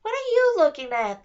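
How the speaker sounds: small and high, a fairy's challenge; fairy snapping at the twins, high and tiny; tiny high-pitched voice. A narrator's reading. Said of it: "in a tiny, high-pitched voice".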